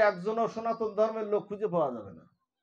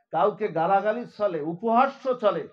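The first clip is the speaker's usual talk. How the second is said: ordinary talk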